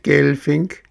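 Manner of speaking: "Gelfink" squeaked high, rising at the end; a teasing shriek from a tiny voice. A narrator's reading. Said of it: "kreischte ein feines Stimmchen".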